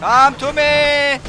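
stretched, slow inviting challenge; slow taunt. Acted. Gamer beckoning the boss, slow mocking call. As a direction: beckon the enemy slowly.

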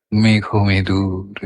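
মেঘ মেদুর low, slow and evenly drawn out; soft, unstressed glide. Shown as a demonstration. Reciter showing the feeling of the word, soft unstressed glide on মেঘ মেদুর. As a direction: let মেঘ মেদুর glide slowly in a low register, no stress. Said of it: "প্রায় ঝোঁক নেই বললেই হয়".

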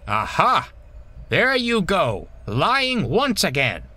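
high, triumphant telling-off; stern accusation. A narrator's reading. Owner catching Bulgy lying once again, stern accusation. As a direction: pounce on the lie.

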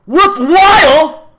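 very high, drawn-out outraged bellow; indignant roar. A narrator's reading. The man explodes at Milo's doubt, roaring indignantly. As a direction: roar the word in disbelief, stretching it. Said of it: "the man roared indignantly".

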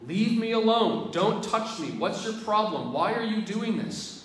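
high, loud, furious retold shout; angry protest. Dying man pushing away Mother Teresa, loud angry shouting. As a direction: shout her off, fighting every step. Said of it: "he is fighting her every step of the way".

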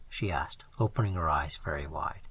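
plain reading voice

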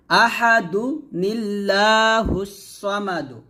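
আহাদু নিল্লাহুস সামাদু chanted slowly, আহাদু joined by noon qutni; slow recitation. Shown as a demonstration. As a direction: recite like tilawat, drawn out. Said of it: "আহাদুন বলবো না, আহাদু বলবো". (ধীরে তিলাওয়াতের সুরে)

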